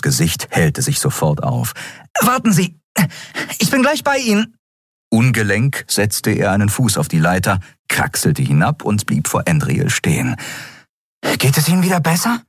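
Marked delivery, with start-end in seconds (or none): 2.2-4.6 put-on very high, bright boyish voice, delighted; eager boy Miko. Miko calls down from the wing. 11.4-12.4 put-on very high, bright boyish voice, delighted; eager boy Miko. Miko asks the captain.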